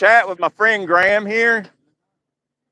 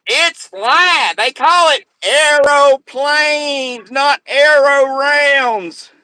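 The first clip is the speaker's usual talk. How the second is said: higher, drawn-out imitation of a buddy; Johnny impression; 5 semitones higher, much slower than usual. Mimicking his buddy answering students about flat Earth, high and drawn out. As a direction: stretch out each word in a raised mimicking voice. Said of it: "And you'll hear Johnny".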